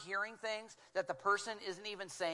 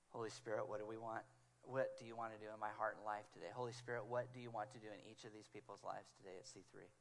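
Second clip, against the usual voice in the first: soft, low, hushed prayer; hushed sincere prayer; quiet prayer; 9 semitones lower, 11 dB quieter, faster than usual. Pretend. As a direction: pray it softly to yourself. A prayer spoken aloud.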